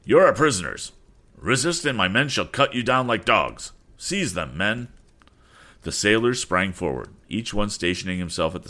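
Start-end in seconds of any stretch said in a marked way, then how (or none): 0.0-4.9 raised, commanding officer's voice; dramatic exclamation. Acted. Officer arresting the crew at sword point, dramatic exclamation. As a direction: exclaim it loud and high, then order your men. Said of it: "with a dramatic gesture, he exclaimed".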